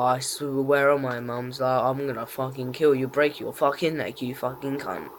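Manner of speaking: quiet menacing threat. Real, not acted. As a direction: mutter the threat through rage. Gamer threatening opponent quietly.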